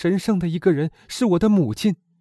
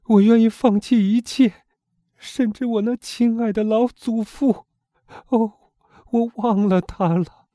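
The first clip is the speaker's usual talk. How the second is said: choked cry; 4 semitones higher, slower than usual. A narrator's reading. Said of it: "瓦朗蒂娜哽咽着喊道".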